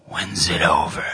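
put-on hushed, bored child at Mass; hushed bored kid asking when it ends; whiny kid whisper. Voicing bored kids at Mass.

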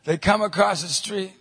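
normal talking voice

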